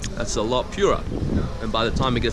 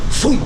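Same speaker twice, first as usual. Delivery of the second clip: loud, high rocket whoosh; whooshing sound effect; 12 semitones higher, 8 dB louder than usual. Retold or imitated. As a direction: blast it off like a rocket. Energy levels soaring, sound effect.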